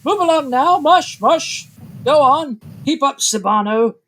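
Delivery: high, loud mushing cries; shouted commands over barking dogs; cart driver yelling. Acted. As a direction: drive on a sled team with loud yells. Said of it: "the sound of someone going".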